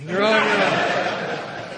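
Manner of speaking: drawn out through laughter; slowed, laughing repeat. Shown as a demonstration. Warning the new guys, drawn out through a laugh. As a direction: stretch it while laughing. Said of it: "If you use them".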